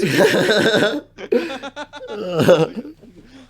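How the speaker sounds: big rising burst of laughter; loud laugh. Real, not acted. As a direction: howl with laughter. Bursts out at bad dialogue.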